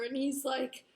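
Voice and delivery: usual speaking voice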